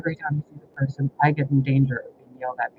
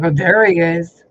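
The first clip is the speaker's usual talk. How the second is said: mocking; 8 dB louder, slower than usual. Husband mocking her outburst.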